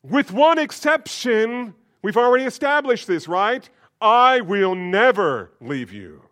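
strutting proud promise; swaggering boast. Acted. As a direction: crow it with full confidence. Peter boasting, very high.

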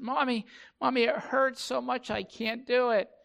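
put-on high, loud childish pleading; whining little kid. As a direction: imitate a hurting child begging mom. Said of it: "the younger of the two kids say".

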